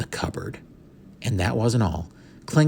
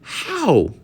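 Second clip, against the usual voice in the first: squawk; 7 semitones higher, 4 dB louder than usual. A narrator's reading. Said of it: "he squawked".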